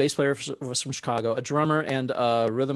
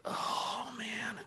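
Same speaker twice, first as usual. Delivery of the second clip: high, hushed sigh of dread; breath-heavy mock despair, very soft; retold dismay; 11 semitones higher, 12 dB quieter than usual. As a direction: whisper it like a sinking realization. Retelling his dismay at second thoughts.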